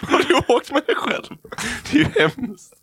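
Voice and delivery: high, squeaky talk through laughter; helpless laughing speech. Real, not acted. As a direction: gasp it out while cracking up. Cracking up at brother's complaint.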